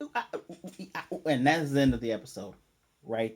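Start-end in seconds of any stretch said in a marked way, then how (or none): 0.0-1.2 high, soft panicked squeals; flustered, breathy little squeals; mock panicked squeaks. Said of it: "she's freaking out like".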